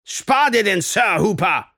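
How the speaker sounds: high, loud angry outburst; angry scolding. A narrator's reading. Angry teacher rebukes schoolboy Hooper, furiously. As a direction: snap furiously at an insolent pupil.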